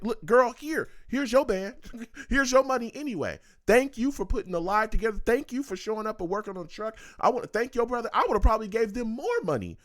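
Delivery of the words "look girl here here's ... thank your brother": raised pitch, mock generous offer to the worker; playful pretend payout. Commentator pretends to pay the food truck worker, pitched up and playful. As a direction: act out handing her the money, higher and lively. Voicing what he'd tell her.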